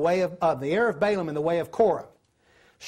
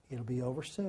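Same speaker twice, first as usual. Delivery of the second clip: soothing reassurance; 7 semitones lower, 10 dB quieter, faster than usual. Retold or imitated. Reassuring the restless old lady at the funeral, softly soothing. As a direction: lower and much softer, gently calming. Patting old lady's hand.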